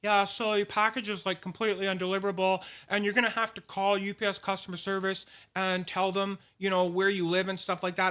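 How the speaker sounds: mock delivery-company voicemail voice; voicemail caller. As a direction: imitate an official rattling off a voicemail. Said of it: "voicemail on my phone saying".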